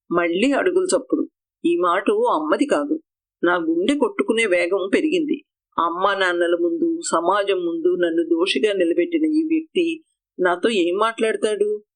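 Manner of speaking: plain reading voice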